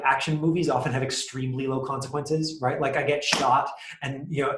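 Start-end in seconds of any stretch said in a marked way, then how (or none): none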